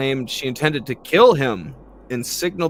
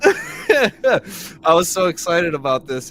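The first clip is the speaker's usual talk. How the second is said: laughing despair; 7 semitones higher, 7 dB louder, slower than usual. Real, not acted.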